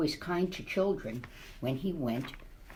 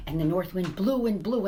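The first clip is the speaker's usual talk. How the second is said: plain reading voice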